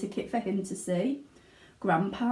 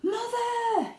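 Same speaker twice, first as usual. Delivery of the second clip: high, breathless cry for mother; yelled; 14 semitones higher than usual. Acted. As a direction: call out as you rush in. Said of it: "yelled Charlie".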